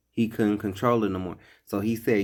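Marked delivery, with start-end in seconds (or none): none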